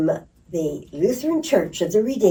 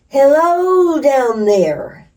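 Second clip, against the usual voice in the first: slow, high, loud call down into the pit; yelling down a hole; 8 semitones higher, 8 dB louder, much slower than usual. Retold or imitated. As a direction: stretch it out, calling down the hole. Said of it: "he yells".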